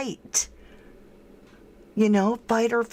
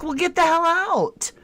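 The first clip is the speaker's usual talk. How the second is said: much higher, incredulous snap; exasperated order, pitched way up; mock exasperated operator; 11 semitones higher, 4 dB louder than usual. Operator telling the caller to leave: incredulous high mock order. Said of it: "And she's like".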